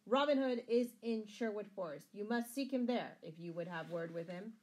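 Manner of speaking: loud, proud, ringing declaration; ringing tones. A narrator's reading. Scarlet defying the sheriff before the crowd, ringing. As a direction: project it boldly to a whole crowd. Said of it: "the reply came in ringing tones".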